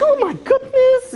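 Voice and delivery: very high, drawn-out mock-girly squeal; girlish shriek. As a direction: squeal it like a girl, hammed up. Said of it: "he's like a girl".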